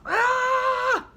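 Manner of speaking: high startled cry; father bitten on the nose, crying out; yelled. A narrator's reading. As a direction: yelp from a sudden bite. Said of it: "he yelled".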